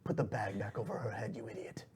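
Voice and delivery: soft, contemptuous boss voice; quiet menace from a crime boss; shadowy boss. Acted. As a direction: scold a lackey softly, fading to a whisper. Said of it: "He's like".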